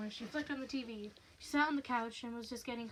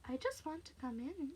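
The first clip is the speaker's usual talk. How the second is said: soft, higher wheedle; creepy, softly pleading taunt; teasing; 4 semitones higher, 5 dB quieter than usual. Acted. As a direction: coax with quiet menace. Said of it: "teasing now, playing with her".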